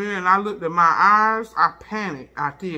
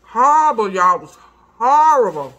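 emphatic loud exclamation; 5 semitones higher, 8 dB louder than usual. Real, not acted. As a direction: stress it hard, loudly. Recalling swollen eyes.

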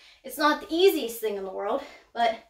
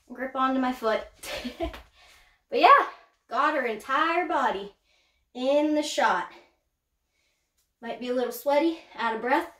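ordinary talk, nothing marked

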